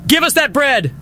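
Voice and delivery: hammed-up angry demand; shouted. A narrator's reading. Dog and cat demanding the bread, mock shout. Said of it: "they shouted".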